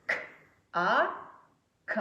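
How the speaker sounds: K as a bare click, A, then kaa; syllable sounded out. Shown as a demonstration. Teacher recalls the syllable, K and A apart then joined. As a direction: separate K and A with pauses, then blend. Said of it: "on a lu tout à l'heure".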